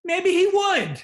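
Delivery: high, loud playful tease; teasing. Acted. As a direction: tease him, voice jumping up.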